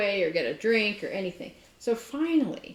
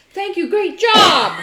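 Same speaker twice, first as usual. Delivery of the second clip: slow, high, shouted congratulations; hammed-up booming approval; mock hearty praise; 11 semitones higher, 15 dB louder, slower than usual. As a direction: belt out the boss's imagined praise. Imagined praise from the boss.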